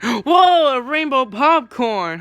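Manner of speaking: loud surprised gasp then wonder; amazed gasp. Acted. Character finding rainbow popcorn, loud amazement. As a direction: gasp and marvel.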